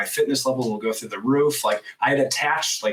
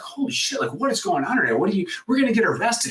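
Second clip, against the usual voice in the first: mildly panicked wife, higher pitch; retold alarm; 10 semitones higher, faster than usual. Voicing alarmed wife in car.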